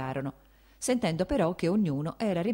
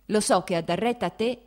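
heated retort; 5 semitones higher, 6 dB louder than usual. Acted. Princess arguing back.

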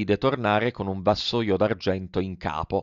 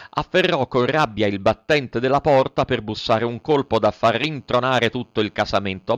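plain reading voice

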